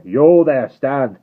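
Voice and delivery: guard threatening the rider; roaring challenge. Acted. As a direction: roar the challenge. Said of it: "as loud as he could roar".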